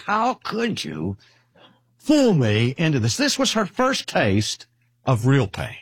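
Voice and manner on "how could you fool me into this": slow, higher-pitched reproach, retold; mock a hurt little girl's accusation; lightly acted wounded disbelief, stretched out; imitated betrayed child. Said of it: "given her the worst betrayal".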